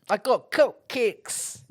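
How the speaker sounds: slow, put-on South African accent; mock South African accent. Friend keeps his accent impression going, in a slow put-on South African accent. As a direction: drag out the cupcakes in a fake South African accent. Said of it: "That's kinda South African".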